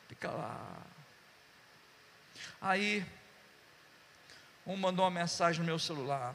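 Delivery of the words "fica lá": low, soft, drawn-out coaxing; softly retold fatherly plea; tender reassurance. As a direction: gently coax her, pitched low and soft. Repeats tender advice to Carol.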